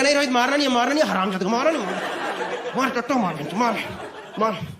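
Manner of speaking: high, fast excited shouts with laughter; hammed-up fight cheering; mock egging on. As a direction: cheer your friend on in a fight.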